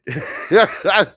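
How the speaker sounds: high, loud burst of laughter. Real, not acted. Storyteller amused by his buddy's mishap, real loud laugh. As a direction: crack up at your own story.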